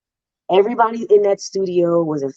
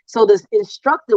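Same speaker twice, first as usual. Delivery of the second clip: ordinary talk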